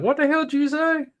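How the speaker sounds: mock bewildered outsider; confused outsider. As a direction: play a stranger who can't understand a word. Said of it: "other people look at us like".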